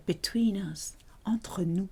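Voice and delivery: hushed, unvoiced aside between two people; confidential whisper. Shown as a demonstration. Teacher whispering "between us" as an example, confidential whisper. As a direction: whisper it like a secret.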